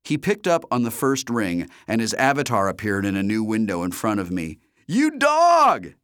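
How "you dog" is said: high, loud hail; delighted shout. A narrator's reading. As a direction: shout it like a proud friend. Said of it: "he shouted".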